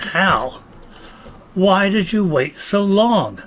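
ordinary talk

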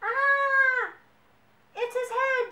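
high, loud storybook shriek of horror; horrified. A narrator's reading. Bibi mistakes a potato for his head, horrified. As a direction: shriek in pretend panic.

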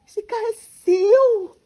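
loud, high, slow gasp of a question; stunned, drag out the words; shocked disbelief. Acted. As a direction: snob realising the worker owns the car, loud and drawn out. Learns the poor man owns car.

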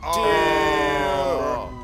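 long, drawn-out "ohhh", falling away; jeering "oh". Real, not acted. Crew reacting to a roast, jeering "oh". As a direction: stretch out a mocking "oh" for the burn.

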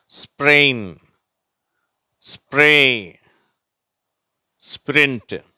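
teacher repeating spr words; model words repeated. Shown as a demonstration. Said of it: "வார்த்தைகளை படிக்கிறேன், நீங்களும் படிங்க".

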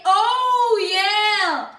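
loud, high celebratory cry; excited cheer. Real, not acted. Gamer cheering a hit on the boss, excited cheer.

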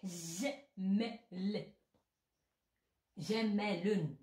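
slowly drawn classical word; slow classical Arabic. Shown as a demonstration. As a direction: mouth it slowly, formal. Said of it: "حنا بالفصحى".

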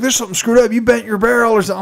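high, insistent buddy; imitated disbelief. Buddy inspecting the rifle after the misses, high and incredulous.

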